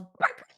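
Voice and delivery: high, loud chicken cry; yelled chicken squawk. Acted. Game master voicing the angry chicken, yelling. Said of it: "It yells".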